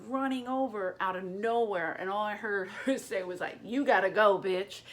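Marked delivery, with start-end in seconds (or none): 3.6-4.8 loud, punchy retold command; tough bouncer-like order. Dice's then wife ejecting the heckler, loud tough order. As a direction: imitate a wife throwing someone out.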